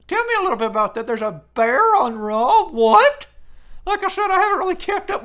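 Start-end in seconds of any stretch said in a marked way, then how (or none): 1.1-3.3 surprised. Real, not acted. Hearing of a bear on Raw.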